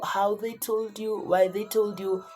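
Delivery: normal talking voice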